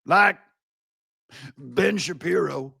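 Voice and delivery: hammed-up warning, pausing before the name; mocking impression of pundit. Host imitating the pundit's rebuke, mock scolding. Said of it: "And he's all".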